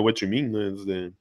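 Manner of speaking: ordinary talk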